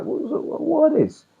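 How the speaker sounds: stunned disbelief. Retold or imitated. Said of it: "And I was like".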